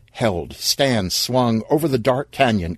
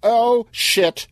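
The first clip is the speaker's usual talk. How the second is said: high alarmed repeat; the panic sinks in now; 13 semitones higher than usual. Acted. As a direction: voice jumps up in alarm.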